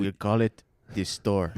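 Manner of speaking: put-on low, slow joking Mexican accent; a hint of a Mexican accent, deadpan. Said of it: "That's my Mexican accent".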